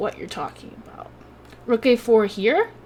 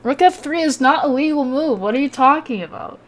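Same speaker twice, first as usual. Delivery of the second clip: higher and louder, indignant at the chat; indignant outburst, louder and higher; mean indignant retort; 6 semitones higher, 7 dB louder than usual. Real, not acted. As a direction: snap back at a chat message in disbelief. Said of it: "Sorry I was so mean to you".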